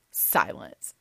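plain reading voice

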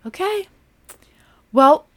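spring the surprise gleefully; big reveal. Retold or imitated. Aunt surprising the girls, high and loud. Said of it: "she's like".

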